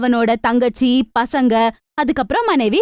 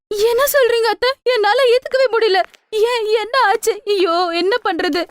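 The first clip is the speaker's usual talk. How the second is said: shocked wife; 7 semitones higher than usual. Acted. Wife hears husband lost job.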